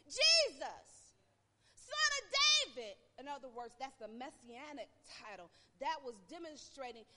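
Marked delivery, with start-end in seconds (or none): 0.1-3.0 loud, high, drawn-out cry; shouting. Retold or imitated. Blind beggar calling out to Jesus, loud high shout. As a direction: call out across the crowd. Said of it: "he began to shout and say".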